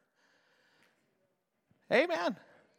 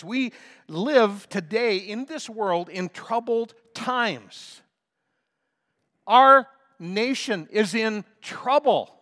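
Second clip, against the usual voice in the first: loud, slow, much higher preacher's cry; preaching at full force; 7 semitones higher, 12 dB louder, slower than usual. Acted. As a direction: thunder it from the pulpit.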